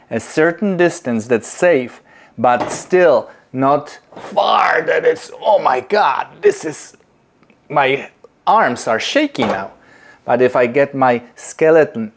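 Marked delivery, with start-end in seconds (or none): none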